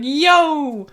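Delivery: high call across the hallway; high-pitched yo called down the hall. Retold or imitated. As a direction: yell after your friend. Calling out to Billy.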